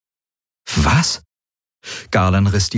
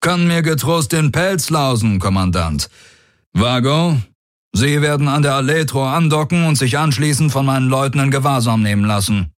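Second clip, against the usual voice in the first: higher, brisk warlord giving orders; commanding warlord; 7 semitones higher than usual. Acted. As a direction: dismiss the threat, then command. Daraios gives orders.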